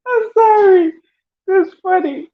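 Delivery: high, laughing apology. Real, not acted. Apologising to sis for finding it funny, laughing. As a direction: apologise helplessly through high-pitched laughter.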